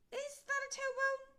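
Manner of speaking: high, loud little skeleton. A narrator's reading. Little skeleton asks the big skeleton, high and loud. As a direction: squeak it like a small puzzled skeleton. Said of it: "said the little skeleton".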